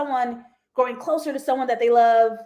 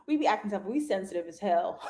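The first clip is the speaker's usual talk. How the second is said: usual speaking voice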